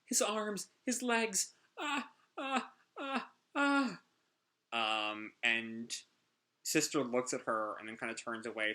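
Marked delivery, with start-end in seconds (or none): 0.1-4.0 put-on much higher, sobbing child voice; crying child. Clean cries over her brother's memory, high sobbing. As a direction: sob out the words like a small girl. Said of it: "Clean is crying".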